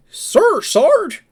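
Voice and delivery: high, loud eager salute; high loud dopey recruit answering his sergeant; goofy Thomas voice. Retold or imitated. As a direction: bark it out like an overeager dim private.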